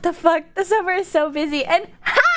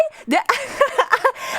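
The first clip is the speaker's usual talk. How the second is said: high giggle; 9 semitones higher than usual. Real, not acted. Laughing at fan encounter.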